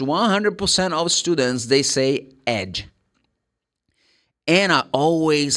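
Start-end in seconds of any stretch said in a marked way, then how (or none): 2.5-2.8 "ed" as a full syllable with an open E; wrong ED ending. Shown as a demonstration. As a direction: say "ed" like the name Ed. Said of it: "The E D can never be pronounced".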